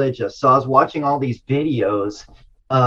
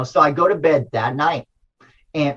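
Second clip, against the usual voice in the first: usual speaking voice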